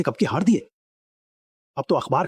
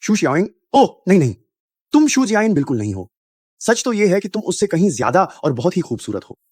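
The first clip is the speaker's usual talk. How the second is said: flustered flattery; 4 semitones higher, 5 dB louder than usual. Acted. Startled, then flattering a woman.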